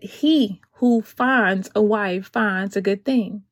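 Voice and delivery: slow, weighty verse reading; solemn slow scripture quote. Acted. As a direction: read the verse slowly like from the pulpit. Quoting scripture to the ladies.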